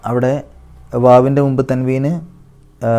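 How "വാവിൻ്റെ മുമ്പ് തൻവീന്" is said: ordinary delivery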